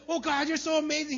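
high, loud adoring praise; lightly voiced adoring worshipper; a hint of gushing worshipper. Voicing worshippers.

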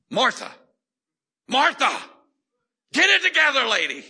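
loud, high exasperated yell; imitation of a furious rebuke; scolding Jesus. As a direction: bellow it as a hammed-up telling-off. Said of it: "I would hear Jesus go".

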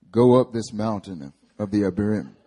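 low, loud voice of God. Acted. Preacher reads God's command to Moses in a deep God voice. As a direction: pitch it down and project like God commanding. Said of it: "It's my God voice".

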